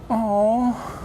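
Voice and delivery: lightly voiced wife melting, with a laugh; sympathetic aww. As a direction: coo like she's moved. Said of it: "she is gonna go".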